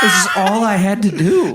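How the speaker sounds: high laughing quote. Retold or imitated. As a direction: laugh through the realization. Voicing skater, laughing.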